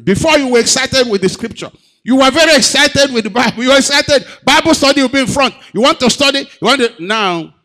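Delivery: very high, loud preaching rebuke; fiery preaching. Acted. Preacher rebuking the congregation for lost zeal, fiery and loud. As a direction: challenge them at full force.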